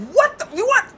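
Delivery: very high-pitched disbelief; acted incredulity. Buyer to car seller over the price, incredulous high outburst. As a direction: squawk it high in mock outrage.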